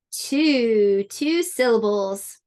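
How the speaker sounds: usual speaking voice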